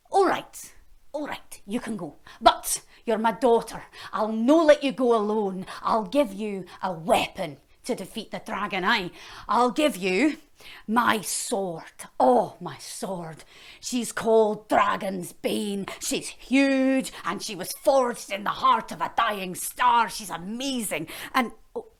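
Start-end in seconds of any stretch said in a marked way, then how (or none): 0.1-12.1 lightly Scottish king voice; a hint of a gruff Scottish father; king boasting to his daughter, light Scottish lilt. King voiced with Scots touches. 12.2-21.1 slow, grand boasting; king showing off his sword, slow and grand; proud boast. Acted.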